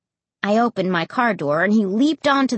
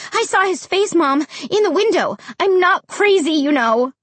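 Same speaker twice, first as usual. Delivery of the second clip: high, urgent, indignant argument; insistent protest; 9 semitones higher, 4 dB louder than usual. A narrator's reading. Child interrupting Mom to be believed, high insistent protest. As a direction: push it out high to convince her.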